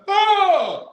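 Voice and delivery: high, loud surprised yelp; mock startled parents. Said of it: "they turned like".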